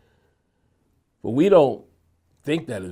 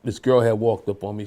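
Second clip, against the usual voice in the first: ordinary talk, nothing marked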